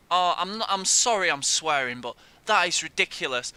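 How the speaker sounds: loud, real indignant ranting; angry rant. Real, not acted. Gamer apologising for swearing while still ranting loudly. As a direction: vent loudly at the decision.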